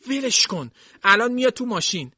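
put-on old servant begging Jason to let go, high; old maid pleading. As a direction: beg him to let her go. Dilsey pleading, separating them.

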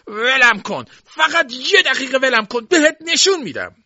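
very high, loud threat; struggling old man shouting. A narrator's reading. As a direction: yell to be let go while fighting free. Old man struggling to break free. (فریاد زیر و تهدیدآمیز پیرمرد)

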